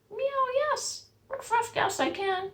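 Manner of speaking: very high eager animal voices. Acted. Storyteller voices the greedy animals saying yes. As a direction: chime in eagerly.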